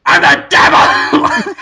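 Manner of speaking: low, loud, slow devil roar; hammed-up devil. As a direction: boom it like a cartoon devil. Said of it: "and he says".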